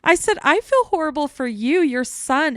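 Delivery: high, loud surprised reply; surprised protest. Retold or imitated. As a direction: insist the pity is for her. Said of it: "I was like".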